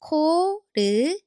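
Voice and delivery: 코르티스 broken into four separate, drawn-out syllables; slow, syllable by syllable. Shown as a demonstration. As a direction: sound out 코르티스 slowly, one syllable at a time. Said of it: "Now connect them slowly".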